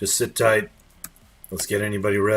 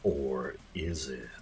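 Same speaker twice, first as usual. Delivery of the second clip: mock ominous; 7 semitones lower, 11 dB quieter, slower than usual. Dramatic mock-ominous reply in game.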